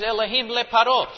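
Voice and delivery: emphatic Hebrew Elohim le Paro. Shown as a demonstration. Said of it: "All it's really saying is".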